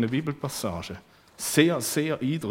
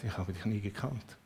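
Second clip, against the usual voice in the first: much softer, low; hushed dread; 4 semitones lower, 10 dB quieter than usual. Acted. Preacher imagining Jesus' verdict on himself, hushed dread. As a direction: say it like a fearful echo.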